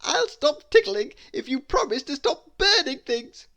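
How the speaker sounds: Mister Tickle setting terms for the dragon; bright, bargaining character voice. Acted.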